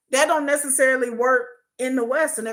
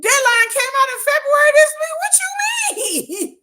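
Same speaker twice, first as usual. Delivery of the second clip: very high, loud and pitched up; incredulous loud squeal; 8 dB louder than usual. Retold or imitated. Correcting a commenter about the release date, shrill disbelief. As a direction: scoff at the absurd claim. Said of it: "I said".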